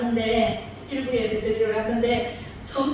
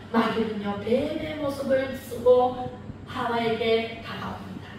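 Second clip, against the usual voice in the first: ordinary talk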